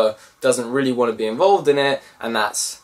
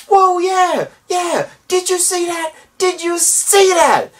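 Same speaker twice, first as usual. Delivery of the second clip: high, loud hammed-up whoop; mock-cheer as if blowing things up is fun; excited cheering; 4 dB louder than usual. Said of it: "And he's going".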